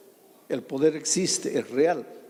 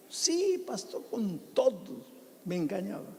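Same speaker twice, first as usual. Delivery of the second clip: imitating the wife; 7 semitones higher than usual. Woman admits husband cheated.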